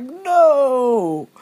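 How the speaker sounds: high, loud cry of despair; a mother at the end of her tether shouting at a dirty nappy; anguished shout. Retold or imitated. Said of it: "And I just shouted out".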